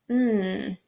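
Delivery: ordinary talk, nothing marked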